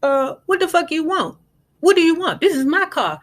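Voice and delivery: high, indignant protest; confused mother. Retold or imitated. A touch of Winter's mother protesting to the feds. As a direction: play the mother who can't believe it. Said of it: "She like".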